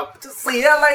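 high-pitched yell of someone snapping; imitated frantic shout. Man retelling a stranded train passenger's outburst, mock-frantic shouting. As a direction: cry out like a stranded passenger losing it. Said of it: "คนเขาจะสติแตกมั้ง แล้วก็ตะโกนออกมา".

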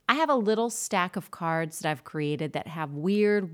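normal talking voice

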